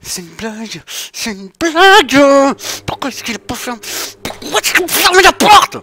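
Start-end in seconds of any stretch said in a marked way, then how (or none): 1.1-2.5 loud, slow outraged C'est une blague; drawn-out exasperated yell. Acted. Dragged out loud repeat. 4.2-5.8 loud high frustrated shout; frustrated yell. Real, not acted. Yelling at the door.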